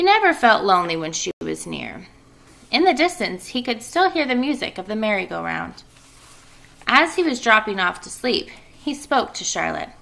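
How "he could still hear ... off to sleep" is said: plain reading voice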